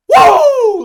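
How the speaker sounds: a loud, high whoop; shrill alley whoop; retold woo yell. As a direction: let out a wild hype yell. Neighbor yelling in the alley.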